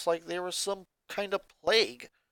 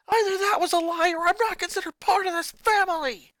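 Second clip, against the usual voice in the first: put-on high, loud furious boy shouting at family; a boy exploding at his mother; angry boy ranting; 7 dB louder than usual. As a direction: snap back at Mom, furious. Lincoln accuses father.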